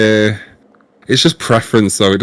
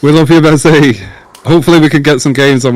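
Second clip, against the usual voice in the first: louder and higher, calling out love to Mezzy; loud shout-out; 6 semitones higher, 7 dB louder than usual. Real, not acted. Guest calling out to departing viewer Mezzy, loud and warm. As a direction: call it out to a friend leaving.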